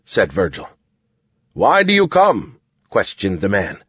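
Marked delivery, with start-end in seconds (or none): 1.5-2.6 higher, formal challenge; soldier herald. A narrator's reading. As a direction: demand it as a guard. Soldier questioning.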